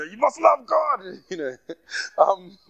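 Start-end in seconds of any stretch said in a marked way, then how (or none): 0.0-1.0 higher and louder, urging; a sudden surge of passion, loud and high; passionate outburst. Real, not acted. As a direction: press it on them with fervour. Passionate plea to congregation.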